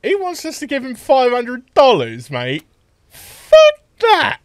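loud outraged shout. Real, not acted. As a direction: yell the refusal. Player mocks then rejects deal.

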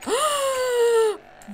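sharp high shocked gasp; loud gasp. Real, not acted.

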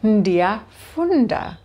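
Ndiyafunda said slowly, every syllable clear; teacher modelling Ndiyafunda slowly; slow model pronunciation. Shown as a demonstration. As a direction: carefully sound out Ndiyafunda for the class. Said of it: "I am reading".

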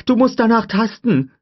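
high, loud frantic order; urgent shout. A narrator's reading. Tim telling Lex to feel for the pin, urgent shout. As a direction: yell it at her.

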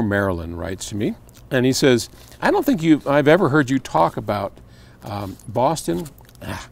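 ordinary delivery

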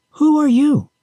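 high, lightly curious question. A narrator's reading. Pandora questions whatever is knocking inside the box, curious. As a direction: ask with a rising spark of curiosity, pitched well up. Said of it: "with a little of her former curiosity".

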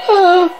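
brief high shriek. Acted. Reader voices the captioned shriek, high shriek.

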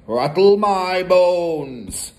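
very slow, eerie parrot wail; spooky parrot. A narrator's reading. Parrot imitates a spooky voice for Matilda, eerie. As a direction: stretch each word like a ghostly parrot. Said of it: "imitation of a spooky voice".

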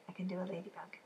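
usual speaking voice

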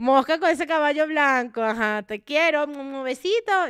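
gushing reply. Retold or imitated. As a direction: gush at your idol like a starstruck fan. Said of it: "yo hago". (fan emocionada respondiendo a [name], con voz aguda y fuerte)

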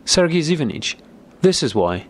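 mild narrated outburst at a name; sudden shout. A narrator's reading. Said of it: "suddenly shouted Nicholas".

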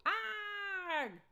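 high, loud startled cry; startled yell. A narrator's reading. Dad ambushed by the kids, startled yell. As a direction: jump out of your skin.

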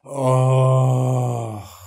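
loud, drawn-out "oh" groan; long relieved groan. Retold or imitated. Man rubbing onion in smoking area, long groan. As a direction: groan it out on one held note.

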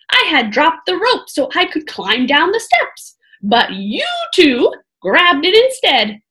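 a kindly monk curious about strangers; storyteller's jolly Brother Patrick, puzzled and friendly; lightly voiced jolly monk. Monk's lines read in character.

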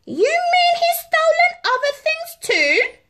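high, loud, shocked royal voice; dismayed Queen. A narrator's reading. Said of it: "cried the Queen in dismay".

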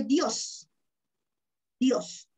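Dios said slowly as a single syllable; one-syllable demo. Shown as a demonstration. Teacher shows pupils Dios is one syllable. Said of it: "Una sola sílaba".

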